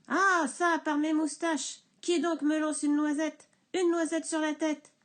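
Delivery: cri furieux, plus aigu et plus fort; cri du bûcheron. Acted. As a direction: crie de colère contre la noisette. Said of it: "le bûcheron crie". (le bûcheron crie de colère)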